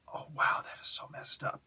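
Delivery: shocked hushed whisper. Retold or imitated. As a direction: breathe it out, stunned. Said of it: "I start just a little bit like".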